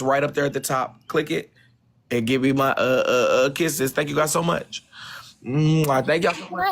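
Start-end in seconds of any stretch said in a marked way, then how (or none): 5.5-6.1 blown kiss. Real, not acted. Blowing kisses to the viewers.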